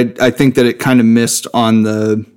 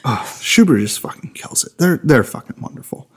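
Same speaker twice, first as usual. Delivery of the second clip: blissful savoring. Real, not acted. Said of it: "having a religious experience over here".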